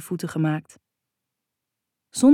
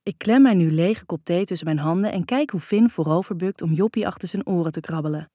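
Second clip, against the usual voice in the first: ordinary delivery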